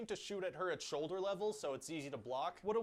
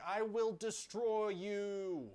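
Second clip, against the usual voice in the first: slow, higher, drawn-out villain threat; hammed-up villain; 5 semitones higher, much slower than usual. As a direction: drag it out like a cartoon baddie. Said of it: "And Ares is gonna be like".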